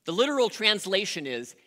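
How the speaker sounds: ordinary talk, nothing marked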